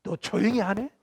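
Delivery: acted angry God threatening his prophet; God snapping back. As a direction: scold like an exasperated father silencing a child. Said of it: "대받아치십니다".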